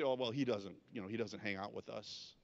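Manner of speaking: low, muttered gossip about him; a hint of coworkers grumbling behind his back; dismissive coworkers. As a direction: shrug him off, talking low among yourselves.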